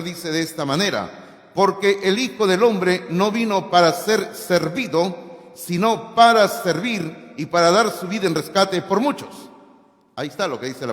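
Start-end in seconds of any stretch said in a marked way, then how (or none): 1.5-9.5 solemn scripture reading. Acted. Preacher reading Mark to the church, solemn and raised. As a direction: proclaim the verse to the congregation.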